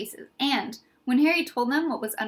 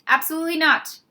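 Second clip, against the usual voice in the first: stern refusal; 5 semitones higher, 7 dB louder than usual. Acted. Strict matron refusing.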